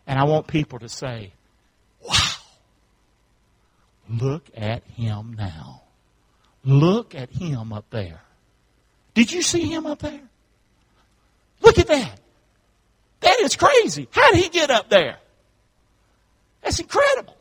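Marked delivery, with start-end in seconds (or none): 2.0-8.2 slow, low, drawn-out wonder; marveling slowly, word by word; awestruck onlooker. Acted. Voices awed onlookers gazing up. 9.1-17.3 high-pitched, excited disbelief; acted astonishment, voice leaping high; amazed onlookers.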